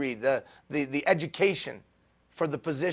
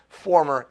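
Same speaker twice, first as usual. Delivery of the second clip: "former" set apart and punched louder; stress on former; 7 dB louder than usual. Real, not acted. Speaker corrects his athlete boast, "former" stressed hard. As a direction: punch the word "former" on its own. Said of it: "emphasis on former".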